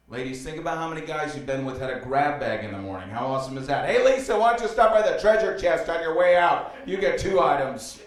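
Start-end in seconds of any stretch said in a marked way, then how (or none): 3.9-8.0 high, loud cheery send-off; calling out. Acted. Comic host offers a grab bag to departing date, calling out. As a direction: call after someone like a prize announcer.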